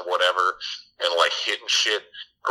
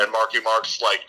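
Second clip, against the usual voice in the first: ordinary delivery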